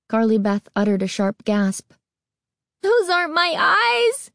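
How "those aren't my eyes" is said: high rising scream, stress on Those; terrified scream. A narrator's reading. Girl staring at strange eyes, high terrified scream. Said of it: "she screamed".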